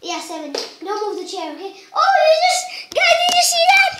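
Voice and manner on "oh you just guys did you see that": high, loud amazed shouting; excited shout. Real, not acted. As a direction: yell it out in disbelief. Vlogger shouts after amazing hit.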